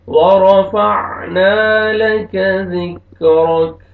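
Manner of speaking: full verse chanted slowly and loud; slow recitation. Shown as a demonstration. Teacher recites the completed verse. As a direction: recite the whole verse drawn out.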